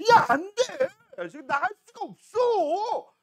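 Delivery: high, drawn-out frantic no; panicked refusal. Acted. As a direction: hold the bladder off in loud, slow desperation. Owner refuses the bursting bladder. (높고 길게 끄는 다급한 거절)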